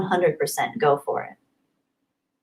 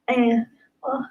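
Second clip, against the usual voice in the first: high breathy whisper, close to tears; tearful whisper; 4 semitones higher, 8 dB quieter than usual. Real, not acted. Streamer overwhelmed by a friend's message, whispered tearful thanks. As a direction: barely get the thanks out.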